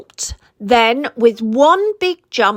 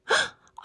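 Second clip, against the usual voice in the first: sharp high gasp; 7 dB quieter than usual. Acted. Sound before reply.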